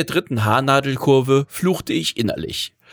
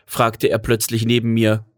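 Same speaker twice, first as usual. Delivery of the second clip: usual speaking voice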